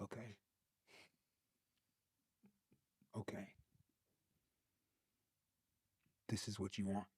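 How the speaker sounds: soft, awed acceptance; hushed. Real, not acted. Speaker, quietly accepting God's direction, hushed. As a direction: let it land quietly, surrendering.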